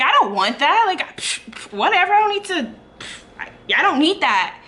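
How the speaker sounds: high, dismissive brush-off with scoffing psh; dismissive refusal. Retold or imitated. Refusing the boyfriend's dare, high and dismissive. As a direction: wave it off like it's beneath you. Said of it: "I was like".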